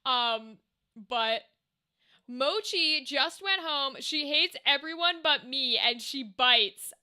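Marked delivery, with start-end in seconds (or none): none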